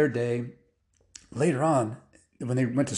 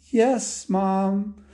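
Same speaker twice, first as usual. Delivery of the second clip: put-on high kid voice; obedient-child act, pitched up; dutiful boys; 7 semitones higher, 3 dB louder than usual. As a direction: play boys humoring their mom. Boys fib to their mom.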